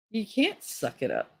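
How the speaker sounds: ordinary delivery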